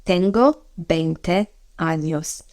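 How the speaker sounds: slow, carefully spaced Spanish; slow model phrase. Shown as a demonstration. Teacher modelling the age phrase slowly. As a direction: say it slowly for learners.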